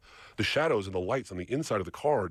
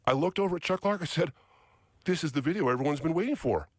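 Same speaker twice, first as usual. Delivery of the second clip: higher, thrilled retelling; excited; 8 semitones higher than usual. Real, not acted. Telling Chuck the video is unbelievable, excited and higher. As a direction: carry the thrill up high.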